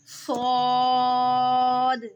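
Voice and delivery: सौद held long, thick and whistling; heavy hissing saad. Shown as a demonstration. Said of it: "इसमें सीटी होती है और मोटा". (सौद मोटा और सीटी के साथ)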